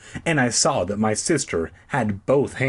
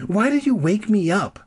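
demanding; 10 semitones higher than usual. A narrator's reading. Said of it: "Jessica demanded".

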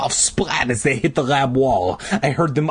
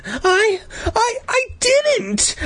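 high, halting stammer; frightened stammer; slower than usual. A narrator's reading. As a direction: trip over the first word in panic. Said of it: "I stammered".

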